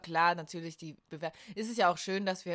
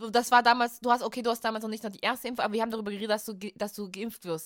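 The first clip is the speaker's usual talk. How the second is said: louder, higher, insistent protest; insisting; 4 semitones higher, 8 dB louder than usual. Real, not acted. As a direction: insist loudly that you're right. Heated podcast argument, insisting.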